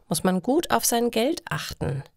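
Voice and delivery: usual speaking voice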